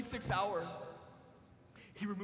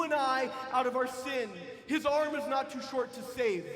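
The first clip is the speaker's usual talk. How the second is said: louder, higher preaching at full force; fiery preaching; 3 semitones higher, 3 dB louder than usual. Acted. Preacher driving home God's rescue to the congregation, fiery and loud. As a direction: preach it home with rising fire.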